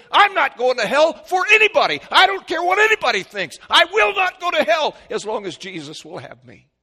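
high, loud defiant cry; desperate declaration. Acted. Convicted sinner crying out to be saved, high and loud. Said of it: "come to the point of saying".

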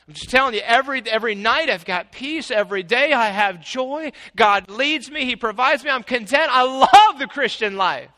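loud, high, rising joy; joyful exclaiming. Acted. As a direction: preach it with soaring joy.

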